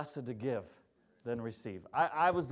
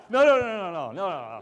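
loud mock refusal; 4 semitones higher, 10 dB louder than usual. Mock polite refusal to dad.

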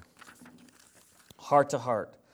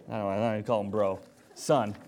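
usual speaking voice

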